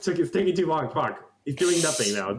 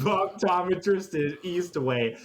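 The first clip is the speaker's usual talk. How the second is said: ordinary talk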